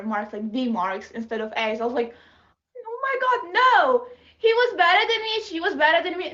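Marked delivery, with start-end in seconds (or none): 2.8-6.3 high squealing dismay, played up for laughs; hammed-up shriek of horror at a B; mock panic. Student upset over a B grade, squealing in mock panic.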